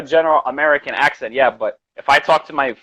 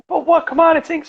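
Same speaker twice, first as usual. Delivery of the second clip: much higher-pitched mock disbelief; exasperated retelling; faster than usual. Teacher recalling students who couldn't understand him, exasperated high-pitched voice. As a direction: squeal it in exaggerated frustration.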